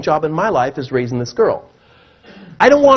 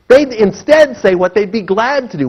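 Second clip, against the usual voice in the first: usual speaking voice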